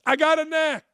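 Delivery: quoting Charlie Sheen. Retold or imitated. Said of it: "to quote Charlie Sheen".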